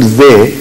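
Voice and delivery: "ze" said with a buzzing z; shown pronunciation. Shown as a demonstration. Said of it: "could not pronounce the word".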